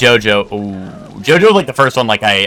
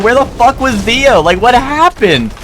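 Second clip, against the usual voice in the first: retold annoyed rant; 12 semitones higher than usual. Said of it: "pissed off, dude".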